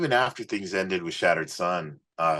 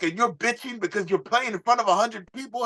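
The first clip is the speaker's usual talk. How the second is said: high, put-on voice of the gruff mentor; imitating Johnny. Manager scolding the young singer, high put-on voice. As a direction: lecture him in a much higher, odd voice. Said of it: "He's like".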